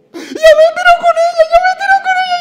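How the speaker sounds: high, cracking wail of a grieving son. Retold or imitated. Son wailing at his mother's burial, high sobbing wail. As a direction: cry out in mock grief at the grave.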